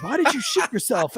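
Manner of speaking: high-pitched mock bewilderment; incredulous dad. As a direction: imitate a baffled father questioning his son. Dad asks son in disbelief.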